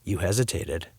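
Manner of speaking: low, soft suspicion; suspicious. A narrator's reading. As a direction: say it slyly, narrowed. Dad squints suspiciously.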